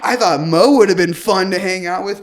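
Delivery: loud, stretched-out mock insults; retold screaming. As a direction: imitate the date's yelling, leaning hard on the words. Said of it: "screaming it".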